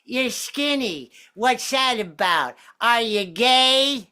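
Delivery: high, slow cartoonish voice; therapist's cartoon voice. Alcoholic therapist quizzing her patient in a cartoon voice. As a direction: drag out each word like a cartoon character. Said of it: "This is gonna sound like a cartoon voice".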